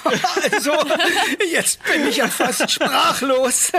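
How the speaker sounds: moved and laughing. Acted. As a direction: laugh through the words, touched. Moved by the surprise.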